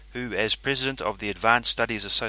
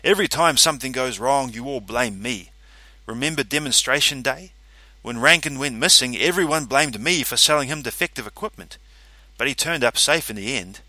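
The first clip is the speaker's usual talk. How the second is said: louder, rising angry outburst; growing angry; 4 semitones higher, 6 dB louder than usual. Acted. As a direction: let the anger build. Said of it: "My voice grew louder as I grew angrier".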